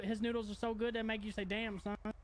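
normal talking voice

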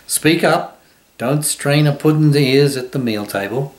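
loud, high, cross scolding; angry shout. A narrator's reading. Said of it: "shouted the pudding angrily".

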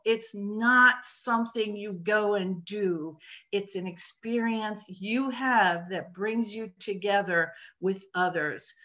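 ordinary talk, nothing marked

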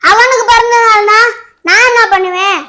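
high, loud furious rant; yelling at the birthday brother, furious; angry yelling. Acted. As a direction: shout threats at your little brother. Sibling yells threats at brother.

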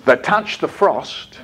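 slow, measured call-and-response line; poem recital. Shown as a demonstration. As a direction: recite slowly for children to echo. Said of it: "exactly as I do it".

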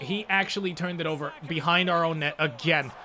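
ordinary delivery

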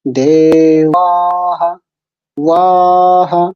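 দেওয়াহা very slow, u and a merged, aa held; joined, long aa. Shown as a demonstration. Teacher showing it said together, দেওয়াহা with long drawn-out aa. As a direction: glide into wa and sustain it in দেওয়াহা, ওয়াহা. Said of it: "এটাকে একসঙ্গে বলতে হবে এবং আকার যেটা আছে দীর্ঘ হবে".

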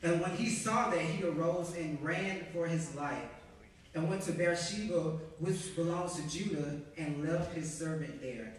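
firm, full-voiced Bible reading; scripture reading. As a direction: read the passage out with weight. Reads scripture aloud to the congregation.

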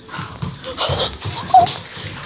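high unvoiced gasp; sharp gasp. Real, not acted. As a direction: gasp in shock. Startled gasp.

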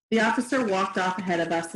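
plain reading voice

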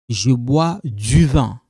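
"Je bois du vin" said slowly, with a clear "du"; correct model sentence. Shown as a demonstration.

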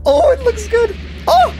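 high-pitched delighted exclamation; real thrilled reaction; excited. Real, not acted. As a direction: let your pitch jump in delight.

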